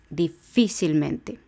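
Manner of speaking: "Difícilmente" slowly, leaning on "fí"; stress on fi. Shown as a demonstration. Teacher showing where the accent falls, stress on "fí" in "Difícilmente". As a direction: draw out "Difícilmente", accent on its second syllable "fí". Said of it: "la anterior a la antepenúltima es fi".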